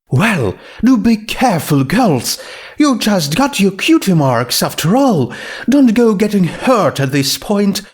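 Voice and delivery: put-on a gentle, elegant warning to the girls; Rarity's voice. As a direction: elegant mare fussing over the fillies.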